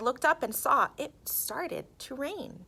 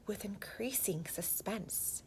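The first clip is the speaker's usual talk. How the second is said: whisper; 12 dB quieter than usual. A narrator's reading. Narrator building suspense before the roll, whisper. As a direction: hush it to build tension.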